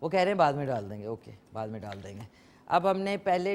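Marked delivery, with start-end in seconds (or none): 1.0-2.2 much softer and lower, muttered to herself; quiet aside. Real, not acted. Host repeating the crew's instruction to herself, softly. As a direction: drop the voice right down as a private repeat.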